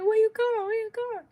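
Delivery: high, loud repeated question; imitated frantic calling. Partner tapping at the car window, imitated urgent calling.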